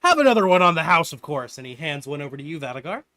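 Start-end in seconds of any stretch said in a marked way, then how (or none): 0.0-1.6 fawning tavern man. Acted. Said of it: "and he's like".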